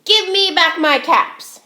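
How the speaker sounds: angry peddler. Acted. Peddler angrily orders the monkeys to return his caps. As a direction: scold them hard, drawing out each word. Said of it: "This made the peddler angry".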